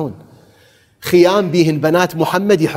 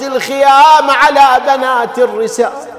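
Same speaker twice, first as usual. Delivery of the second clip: high, loud mourning cry to the crowd; full-force lament preaching; 11 semitones higher, 6 dB louder than usual. Acted. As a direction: preach the tragedy at the top of your voice. (خطابة حسينية بأعلى صوت)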